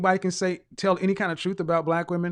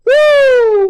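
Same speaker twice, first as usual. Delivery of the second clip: high loud whoop; real gleeful whoop; 14 dB louder than usual. Real, not acted. Shout of glee.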